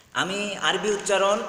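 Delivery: ordinary talk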